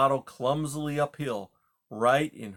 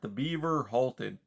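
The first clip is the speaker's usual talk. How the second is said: plain reading voice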